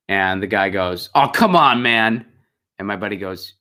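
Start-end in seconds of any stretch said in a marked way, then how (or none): 1.2-2.2 mock indignant complaint; exasperated protest. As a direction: whine it like an entitled driver told to move. Retold argument, truck owner protesting.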